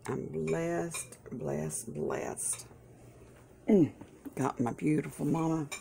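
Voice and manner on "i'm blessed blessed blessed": softer each time until whispered; fading to a whisper. Real, not acted. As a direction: let each blessed fade to a breath. Counting blessings, fading out.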